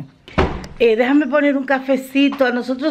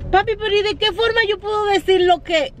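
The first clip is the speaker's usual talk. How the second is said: exasperated protest; 9 semitones higher than usual. Retold or imitated. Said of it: "digo yo".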